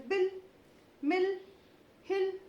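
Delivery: बिल, मिल, हिल with a clipped short इ, rising high; बिल, मिल, हिल said with a quick short i sound; short vowel demo. Shown as a demonstration. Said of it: "short में बोलेंगे".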